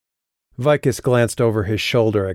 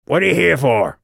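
high goblin voice; high, blunt goblin question; 7 semitones higher, 4 dB louder than usual. A narrator's reading. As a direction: demand it like an impatient goblin guard. Goblin gives up on formality.

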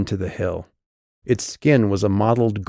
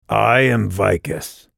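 slow, formal announcement; measured official voice; on-the-job voice; slower than usual. A narrator's reading. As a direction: announce yourself slowly and officially. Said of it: "what she had dubbed his on-the-job voice".

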